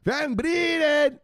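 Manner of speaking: high, loud, slow shout; phone yelling the catchphrase through speakers; phone blaring. Retold or imitated. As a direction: blast it like a phone at full volume. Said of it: "it went".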